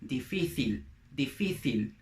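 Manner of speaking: "difícil" with a th sound, dee-FEE-theel; Spain Spanish. Shown as a demonstration. Teacher contrasts Spain with Latin America, Castilian pronunciation. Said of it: "Or in Spanish from Spain".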